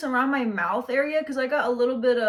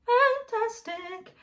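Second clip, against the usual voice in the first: high, slow ironic praise of the word; sarcastic drawn-out; 13 semitones higher, much slower than usual. Real, not acted. She mocks her bad lighting as fantastic. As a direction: stretch it out with mock cheer. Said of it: "sarcasm about the lighting".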